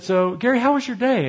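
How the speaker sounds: lightly voiced wife, pitch lifting high; imitated wifely greeting. Wife asking Gary about his day at home, high-pitched greeting. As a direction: lift it into a high, inquiring greeting.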